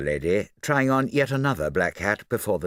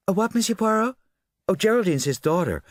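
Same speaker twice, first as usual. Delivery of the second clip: high, offhand society lady; distracted socialite; 13 semitones higher, 4 dB louder than usual. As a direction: toss the answer off, half listening.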